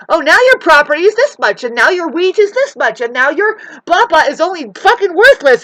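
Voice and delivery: loud, high mocking voice quoting prices; mock pricing voice. Ranting vlogger mimics the system devaluing property, loud and high.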